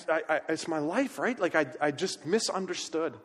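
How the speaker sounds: normal talking voice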